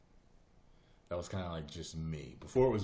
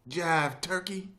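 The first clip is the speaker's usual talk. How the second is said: high, slow, loud jive caricature; playful loud jive imitation; old-time jive talker; 12 semitones higher, 6 dB louder, slower than usual. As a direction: draw out the retro slang, hammed up. Repeats the jive impression.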